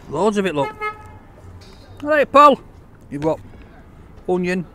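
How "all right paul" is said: calling out. Real, not acted. Calling out greeting to Paul.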